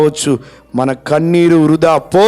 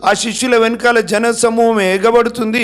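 rebuking; 7 semitones higher than usual. Retold or imitated. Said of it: "గద్దించారు".

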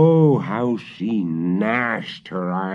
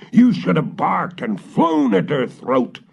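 higher, louder angry yell; witch shouting; 9 semitones higher, 4 dB louder than usual. Acted. The witch shouts at the dog, high and angry. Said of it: "shouted the witch".

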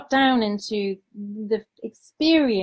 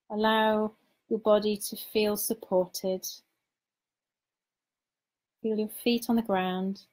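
guided meditation. Acted. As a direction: lead them gently into calm, unhurried.

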